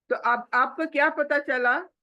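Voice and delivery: usual speaking voice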